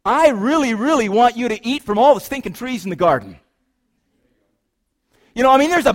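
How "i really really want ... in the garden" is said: higher and louder, gushing with delight; God begging them to eat, over the top; excited paraphrase of God. Acted. Preacher's loose retelling of God's offer, big and excited. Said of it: "here's [name]'s translation".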